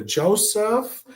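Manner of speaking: put-on high, doting old aunt calling her nephew; imitating Aunt Millie. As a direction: coo it high like a fussing Italian aunt. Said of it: "she would always go".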